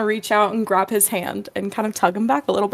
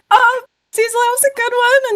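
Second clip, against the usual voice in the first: higher, laughing gushing praise; 11 semitones higher than usual. Acted. Beast praising Cecil's joke, high laughing gush. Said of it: "Beast laughs at the joke audibly, goes".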